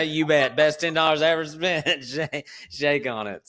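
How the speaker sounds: mock triumphant reply; gleeful. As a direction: crow over the bargain.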